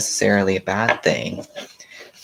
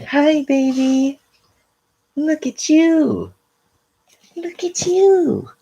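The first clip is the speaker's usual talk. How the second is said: talking to a puppy; 5 dB louder, slower than usual. Real, not acted. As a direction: coo at the puppy, very high. Greets her puppy.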